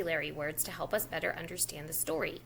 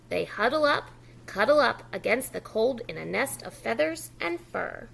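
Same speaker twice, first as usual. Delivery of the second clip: lively louder story reading; reading to children; 5 dB louder than usual. Acted. Teacher reading the book aloud to young children. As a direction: read the snow story aloud to a preschool audience.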